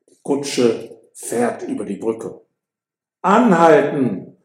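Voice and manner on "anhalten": slow, loud, high call to the coachman. Acted. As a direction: stop the carriage with a call. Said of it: "ruft Romeo dem Kutscher zu". (Romeo ruft dem Kutscher laut und langsam zu)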